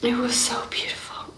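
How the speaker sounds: soft breathless whisper, barely voiced. Real, not acted. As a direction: whisper it as if overwhelmed. Whispered after emotional moment.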